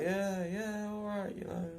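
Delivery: high, mocking Steve voice; lightly hammed-up Steve; imitating Steve. Steve answering in the shop, high mock voice.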